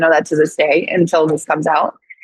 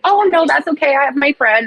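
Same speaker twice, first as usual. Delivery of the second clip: polite high refusal; 7 semitones higher than usual. Retold or imitated. Politely declining again.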